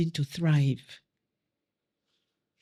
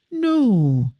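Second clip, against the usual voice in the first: firm loud no; 4 semitones higher, 6 dB louder than usual. Retold or imitated. Refusing uninvited visitors at door.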